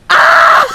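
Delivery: loud high yelp; sudden scream; surprised scream. Real, not acted. Falling down the stairs.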